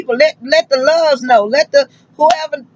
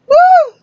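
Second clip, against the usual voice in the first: a sudden high, loud whoop; 6 dB louder than usual. Real, not acted. Trucker whoops out loud to her viewers. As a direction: let out a joyful high yell.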